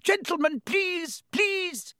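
very high-pitched plea; crying out. A narrator's reading. The shop assistant pleads with brawling customers, crying out. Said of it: "cried the assistant".

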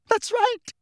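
very high, frightened squeak; tiny, scared high voice. A narrator's reading. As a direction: squeak it out at the top of your range. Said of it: "in an even higher voice".

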